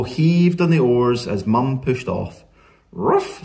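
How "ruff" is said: high-pitched little dog yap; sharp, squeaky ruff; dog bark. A narrator's reading. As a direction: bark like an excited small dog. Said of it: "said Ebb".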